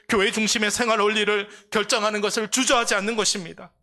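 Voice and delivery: high, loud fervent sermon; preaching at full force. Real, not acted. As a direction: drive the message home to the congregation. (회중에게 높고 크게 외치는 열정적인 설교)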